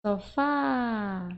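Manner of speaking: "Sofá" with the last syllable "fá" held long; stretched stressed syllable. Shown as a demonstration. Teacher giving a spelling tip, "fá" drawn out in "Sofá". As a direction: linger on the "fá" of "Sofá". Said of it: "vai ser na que eu demorar mais falar".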